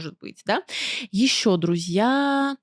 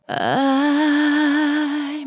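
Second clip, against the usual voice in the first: breathy subtone; 5 semitones higher than usual. Shown as a demonstration. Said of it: "придыхание, субтончик".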